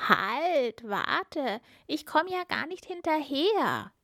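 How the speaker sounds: high frog voice, calling without much volume; frog calling after. A narrator's reading. Frog left behind by the princess, calling after. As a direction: call after someone getting away, pitch climbing. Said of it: "rief der Frosch".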